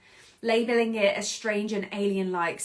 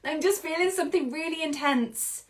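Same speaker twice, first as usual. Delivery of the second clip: high, loud outburst on No; a hint of an exasperated kid; mock exclaim; 6 semitones higher, 3 dB louder than usual. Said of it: "It's like".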